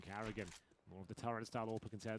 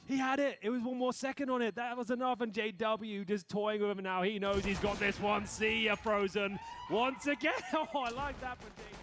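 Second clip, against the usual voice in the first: hyped caster yelling, higher and louder; excited shout; 11 semitones higher, 8 dB louder than usual. Real, not acted. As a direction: erupt over the clutch play. Caster erupts at clutch play.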